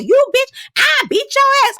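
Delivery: very high mock threat; imitating Alexis. Mocking Alexis threatening Mika in a high squeal. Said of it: "Alexis was like".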